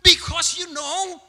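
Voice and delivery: loud, high defensive comeback; high-toned retort. Shown as a demonstration. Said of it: "That's the tone". (balasan keras bernada tinggi)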